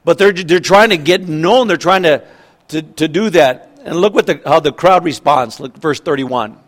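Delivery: ordinary talk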